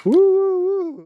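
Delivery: high joyful whoop; excited cheer. Real, not acted. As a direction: let out a big happy woo. Cheering on reaching mile three.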